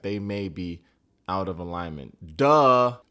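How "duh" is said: loud, high, mocking exclamation; sarcastic shout. Real, not acted. Recapper mocks their being out of alignment, sarcastic shout. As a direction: belt it out as blatantly obvious.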